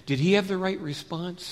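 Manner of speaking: plain reading voice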